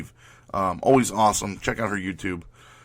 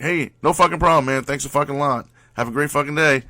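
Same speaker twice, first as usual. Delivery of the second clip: louder and higher, mock-cheerful snark; sarcastic loud thanks; 5 semitones higher, 5 dB louder than usual. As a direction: throw it back at her cheerfully rude. Sarcastic send-off to cashier.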